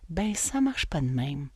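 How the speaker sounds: low, soft, slow correction; quietly matter-of-fact after the parody; deadpan letdown. Real, not acted. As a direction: drop down and burst the bubble. Deflates the mocked enthusiasm.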